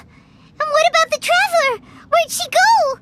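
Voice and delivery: high-pitched worried questions; alarmed questioning. Acted. Character notices companion is missing.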